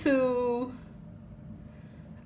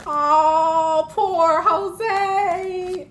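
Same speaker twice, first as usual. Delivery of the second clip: mock pity; 7 semitones higher, 4 dB louder, much slower than usual. Mock pity for hurt Jose.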